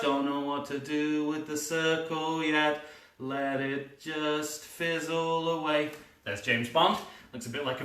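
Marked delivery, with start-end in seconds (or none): 0.0-5.9 slow, quiet, drifting thought; slow musing. Acted. Filmmaker unsure about the circle, slow and musing. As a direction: let the words fizzle out.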